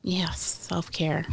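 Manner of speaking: slow whispered aside; whispering. Acted. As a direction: whisper it, slowly. Mock-solemn aside on self-care.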